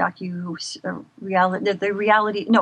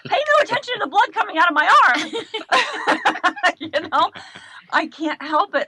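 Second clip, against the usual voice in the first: high, loud comic announcement; performer's showy bit; 11 semitones higher, 4 dB louder than usual. Acted. Patient entertaining the doctor during a blood draw, showy performer voice. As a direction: play to the room, pitched way up.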